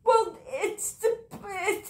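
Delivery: high, sobbing broken words; crying. Acted. Character crying while explaining about the mother. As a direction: choke the words out through tears.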